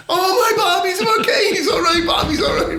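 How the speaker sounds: very high, wailing mock relief; hammed-up wino relief. Fake wino telling firemen his partner revived, high wail. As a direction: squeal it high with laughter in it. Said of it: "you're way in character".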